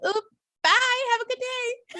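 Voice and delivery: very high, airy mock farewell; breezy goodbye. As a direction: toss off an innocent, chirpy exit. Acting out woman's breezy exit.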